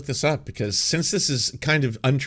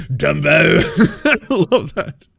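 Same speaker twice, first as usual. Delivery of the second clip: laughing through the word Dumbo; giggling with real delight; real laughter, words squeezed out; 4 semitones higher, 6 dB louder, much slower than usual. Real, not acted.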